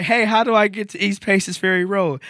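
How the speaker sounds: calling out. Retold or imitated. Lost driver calls out to joggers, high and loud. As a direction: shout the question across the street.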